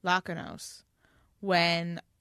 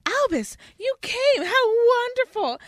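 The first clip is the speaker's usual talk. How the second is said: much higher, overjoyed gushing greeting; gushing Delphi; 5 dB louder than usual. Acted. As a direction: squeal with delight at seeing him. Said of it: "and then Delphi appears".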